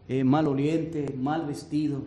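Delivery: ordinary delivery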